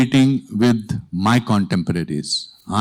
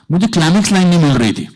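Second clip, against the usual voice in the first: ordinary delivery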